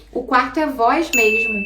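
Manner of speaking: normal talking voice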